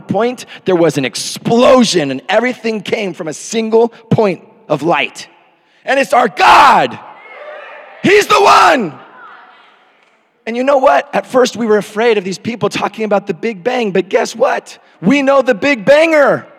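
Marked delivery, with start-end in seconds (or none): 5.8-16.5 high, loud revival preaching; preaching shout. Acted. Pastor shouting to his church about God's power.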